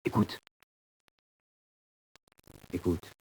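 très bas et doux; écoute le couloir en chuchotant; chuchotement tendu. Acted. (murmure inquiet dans la cellule)

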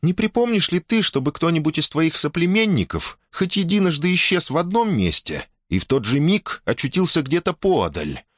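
high, careful questioning; princess voice. A narrator's reading. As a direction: ask the old shaman gently. Princess asks the shaman.

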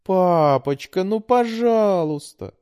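slow, high pleading plea; begging. A narrator's reading. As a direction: beg sweetly and drawn out. Daughter begs father.

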